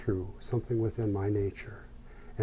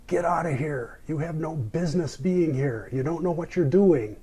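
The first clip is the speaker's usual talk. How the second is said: put-on higher-pitched scolding shout in a German accent; yelled German accent; 7 semitones higher, 4 dB louder than usual. Old man shouts at floundering windsurfer, yelled German accent. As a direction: imitate an old German man yelling across the water. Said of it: "he yells out into this jeep, dirt, deep, uh, German accent".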